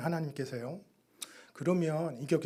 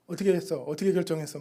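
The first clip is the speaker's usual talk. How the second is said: impatient demand; 3 semitones higher, 8 dB louder, faster than usual. Retold or imitated. Pressing senior missionary on phone.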